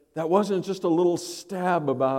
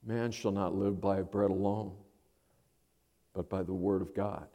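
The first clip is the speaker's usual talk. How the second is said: much softer, low, steady; calm firm reply; 6 semitones lower, 9 dB quieter than usual. Acted. Jesus answering Satan, soft and steady. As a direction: answer quietly and firmly. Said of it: "Jesus turns around and says".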